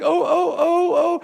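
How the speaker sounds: high, whimpering oh oh, hammed up; a scared little man cowering in his car; mock frightened whimper. Timid driver cowering, retold.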